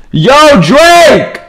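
high, loud shout across the map; calling out loud. Real, not acted. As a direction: hail him from far away. Calling out to player Drake.

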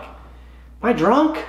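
slow, high, bewildered question; baffled self-questioning. Retold or imitated. Golfer questioning himself after mishearing cart girl, mock bewildered. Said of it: "and I'm like".

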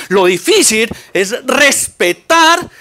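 slow, drawn-out "respetar"; stress on respetar. Shown as a demonstration. Urging parents to respect their children, stretching "respetar". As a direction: lean hard on "respetar". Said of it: "contrast with easy".

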